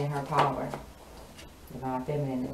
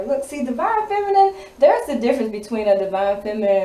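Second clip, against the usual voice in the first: excited; 6 semitones higher, 9 dB louder than usual. Real, not acted. Excited outburst about the reading.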